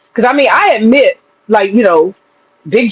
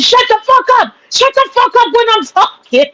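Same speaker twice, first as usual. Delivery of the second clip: high mock furious shout; imitating a man yelling to shut up; hammed-up angry shout at a rival; imitating Big J yelling; 12 semitones higher than usual. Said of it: "He said".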